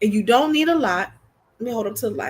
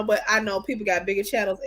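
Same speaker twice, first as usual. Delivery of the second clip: ordinary talk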